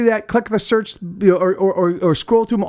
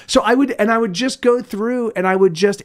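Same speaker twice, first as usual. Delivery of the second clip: normal talking voice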